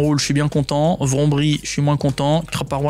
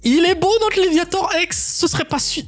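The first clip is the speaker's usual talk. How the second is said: much higher, slow, drawn-out hype; thrilled announcement; 12 semitones higher, slower than usual. Real, not acted. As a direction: present it like a trophy. Legendary card pulled.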